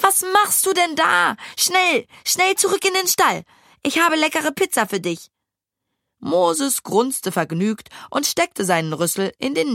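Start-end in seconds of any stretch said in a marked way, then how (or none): 0.0-5.3 put-on coaxing girl. A narrator's reading. Girl coaxing pig back.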